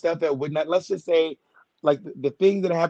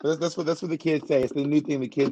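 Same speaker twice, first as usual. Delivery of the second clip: usual speaking voice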